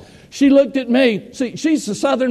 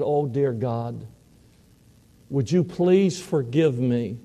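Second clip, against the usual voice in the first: tearful prayer; 7 semitones lower, 5 dB quieter than usual. Retold or imitated. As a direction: pray quietly and brokenly as if weeping. And I wept again.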